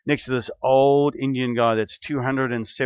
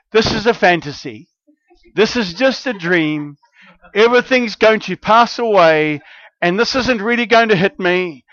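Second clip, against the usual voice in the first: panicked protest; 8 semitones higher, 6 dB louder than usual. Retold or imitated. Panicked plea to God, retold.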